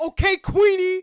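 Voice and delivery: loud, slow, sneering taunt; mocking sneer. [name] sneering loudly at Claire about her friends. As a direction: drawl it like a put-down.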